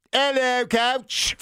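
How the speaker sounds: put-on high, posh British reporter accent; mock British journalist greeting the coach; hammed-up English reporter accent. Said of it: "play the role of the British reporter".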